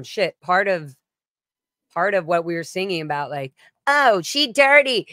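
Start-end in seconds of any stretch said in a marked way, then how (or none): 3.9-5.1 sassy lyric voice. Retold or imitated.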